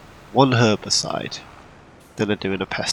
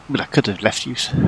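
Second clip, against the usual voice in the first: ordinary delivery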